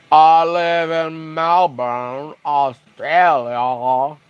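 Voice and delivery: put-on very slow, dragged-out Australian accent; dopey Australian accent. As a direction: stretch every vowel in clumsy Australian. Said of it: "Australian speaking".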